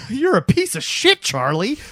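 high-pitched mocking impression; mock insult in a squeaky put-on voice; sneering friend. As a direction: imitate the obnoxious friend, voice shooting up. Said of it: "that's his attitude to him".

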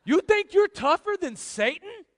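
high, loud, drawn-out challenge; incredulous shout. Real, not acted. Preacher challenging those who share the meme, incredulous shout. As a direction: shout the question in disbelief.

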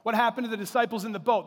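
usual speaking voice

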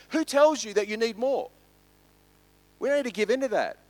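full-force preaching. Acted. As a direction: press the congregation with each question.

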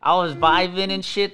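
bubbling over with giggles; laughing. Real, not acted. Laughs describing good mood.

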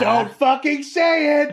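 high, drawn-out mock yell; imitated crowd heckle, high and slow; retold audience outcry. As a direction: plead like an exasperated moviegoer, stretching it out. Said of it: "everybody in the audience goes".

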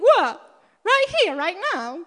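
sharp high what, then right here right now; startled disbelief. Retold or imitated. Asked to pray on the spot, startled and incredulous. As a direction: caught off guard by the request. Retelling her startled reply.

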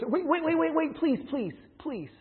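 high, squeaky little mouse begging; squeaky mouse pleading for mercy. Retold or imitated. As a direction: beg like a tiny frightened mouse. Mouse pleading in told fable.

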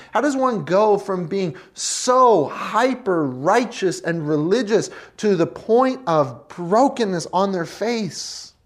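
ordinary talk, nothing marked

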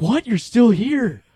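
high, loud startled what; surprised driver. Retold or imitated. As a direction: driver shocked to find him still aboard.

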